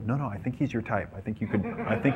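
soft, confidential mock sincerity; quiet playful insistence; teasing reassurance. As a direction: keep it hushed as you egg your companion on.

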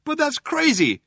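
high-pitched protest; Trillian crying out. A narrator's reading. Trillian protesting the cops' threat, crying out. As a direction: cry out in protest, voice well up. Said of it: "cried Trillian".

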